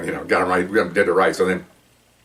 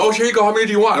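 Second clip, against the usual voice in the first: high, bright-eyed mock eagerness, much higher pitch; eager witness voice; 11 semitones higher, 4 dB louder, faster than usual. Witness offering leaflets to the undercover cops, mock eager and high. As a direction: play the overjoyed Witness handing out leaflets.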